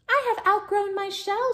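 high, small-creature voice; little hermit crab. A narrator's reading. Smaller crab passing by tells her trouble, high voice.

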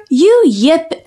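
usual speaking voice

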